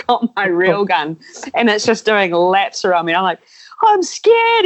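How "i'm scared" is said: very high retold panic; mock frightened squeal. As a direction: squeak it out like a scared beginner. Acts out his own fright.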